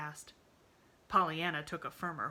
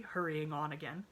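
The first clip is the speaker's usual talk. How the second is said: usual speaking voice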